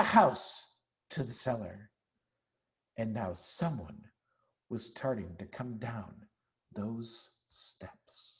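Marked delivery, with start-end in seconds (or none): 3.0-8.3 hushed suspense. A narrator's reading. Suspense as someone descends.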